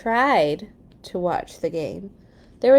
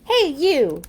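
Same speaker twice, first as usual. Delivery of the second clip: higher, louder shout of a camera woman; 6 semitones higher, 4 dB louder than usual. A narrator's reading. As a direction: call out sharply across the crowd. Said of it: "shouted the camera woman".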